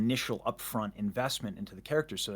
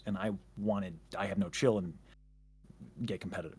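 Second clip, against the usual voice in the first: ordinary talk, nothing marked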